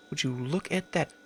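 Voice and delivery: whispered, breathless wonder; half-joking awed whisper; hushed awe. Acted. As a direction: whisper it like a treasure. Hushed awe at found food.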